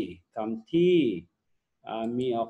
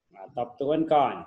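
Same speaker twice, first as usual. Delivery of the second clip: ordinary talk, nothing marked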